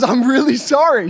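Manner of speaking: mock apology. Wife apologizing in retold story.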